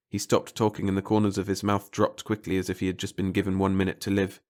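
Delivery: ordinary delivery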